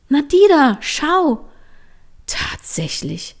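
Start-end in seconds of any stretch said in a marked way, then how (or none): 0.1-1.5 excited pointing. Acted. Trini pointing out tracks excitedly.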